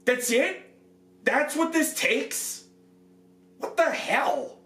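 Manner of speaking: high, slow, incredulous questions; stunned that so little broke it; exasperated disbelief. Real, not acted. Reviewer venting at the armor's fragile lacing, incredulous and exasperated. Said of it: "this is really frustrating".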